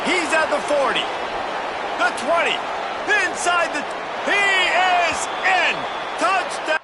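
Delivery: rising excited play-by-play call; touchdown call. Real, not acted. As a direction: build to the score, high and urgent.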